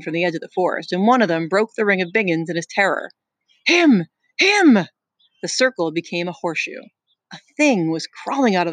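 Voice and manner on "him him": high, loud panicked shout; terrified shout. Acted. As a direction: scream it out in panic. Boy screaming in terror.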